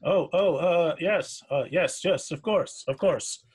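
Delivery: jumpy, hasty agreement; nervous sailor. Acted. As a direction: stammer out an eager, startled yes. Shaken sailor answers nervously.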